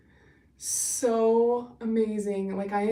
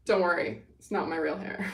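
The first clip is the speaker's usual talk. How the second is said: ordinary talk, nothing marked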